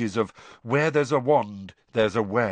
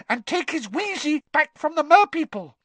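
squeaky house elf. Acted. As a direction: squeak it out urgently. Dobby voiced.

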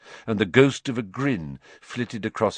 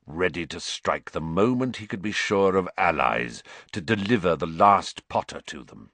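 ordinary delivery

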